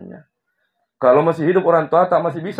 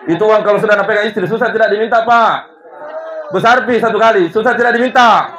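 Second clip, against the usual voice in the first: higher, louder, faster teasing with laughter; laughing tease; 9 semitones higher, 7 dB louder, faster than usual. Real, not acted. Preacher teasing husbands about wives holding money, laughing. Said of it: "bapak-bapak saya tanya".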